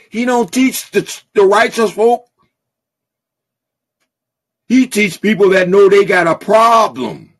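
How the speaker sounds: preaching at full force. Real, not acted. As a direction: build the call until it rings out.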